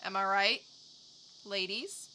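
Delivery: ordinary talk, nothing marked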